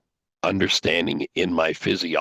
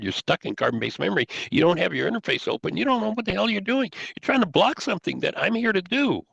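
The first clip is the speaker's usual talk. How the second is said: rebuking; 12 semitones higher than usual. Retold or imitated. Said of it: "Yeshua rebuking Peter".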